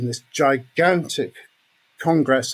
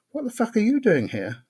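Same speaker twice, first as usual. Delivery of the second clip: surprised greeting; 9 semitones higher than usual. Retold or imitated. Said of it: "And he said to me".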